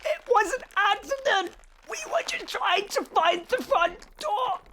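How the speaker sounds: high, loud begging; desperate pleading. Acted. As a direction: beg to be let down. Begging while hanging in vines.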